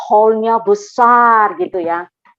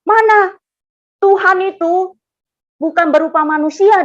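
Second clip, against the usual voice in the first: teguran tiruan dengan nada tinggi dan keras; menirukan teman marah; 8 semitones higher, 6 dB louder than usual. Retold or imitated. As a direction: tirukan teman yang membantah dengan gusar. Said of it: "dia marah saya". (menegur keras seperti teman yang marah)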